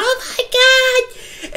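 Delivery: high, panicked "oh my God"; mock panicked shriek. Granddaughter in back seat freaking out, mock panicked shriek. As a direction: squeal it like a scared girl.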